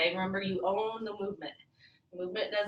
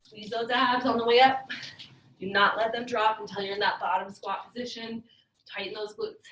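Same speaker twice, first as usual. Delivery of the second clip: aerobics instructor; 2 semitones higher, 4 dB louder than usual. Acted. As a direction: push the class through the rep. Instructor urging class mid-set.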